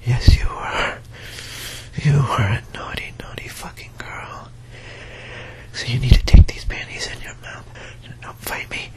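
breathy close-mic whisper; intimate whisper. Acted.

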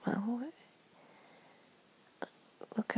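normal talking voice

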